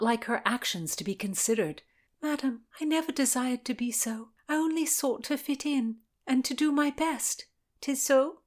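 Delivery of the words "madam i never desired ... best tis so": put-on higher, pleading young woman; young lady voice. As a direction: beg her pardon earnestly.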